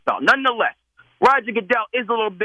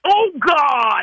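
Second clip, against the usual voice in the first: much higher, exaggerated Boston Oh, God; New England accent; 8 semitones higher than usual. Caller says Oh, God in a high New England accent on request. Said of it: "he went up a couple octaves with it".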